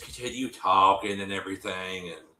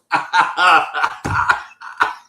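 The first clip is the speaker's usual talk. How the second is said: loud, breathless cackling laugh; real burst of laughter; 7 semitones higher, 8 dB louder than usual. Real, not acted. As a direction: crack up hard at your own joke. Cracks up at own imitation.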